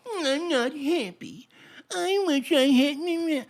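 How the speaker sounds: high, slow, drawn-out mimicked whine; hammed-up, muffled royal moping; mock royal whining. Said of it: "and they're all like".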